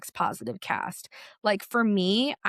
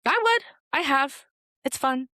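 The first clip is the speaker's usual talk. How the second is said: defiant excitement; 6 semitones higher than usual. Real, not acted. Answering online critics about girl talk, high and defiant. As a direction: proudly own it, pitch flying up.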